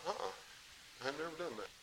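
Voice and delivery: whispered private answer; inner thought. Retold or imitated. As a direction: murmur it to yourself. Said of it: "And I thought".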